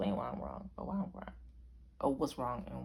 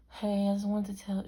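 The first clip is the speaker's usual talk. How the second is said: higher, louder sister impression; raised-pitch, forward phone greeting; Brianna; 5 semitones higher, 5 dB louder than usual. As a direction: imitate a sister's opening hello on the phone. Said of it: "And Brianna's like".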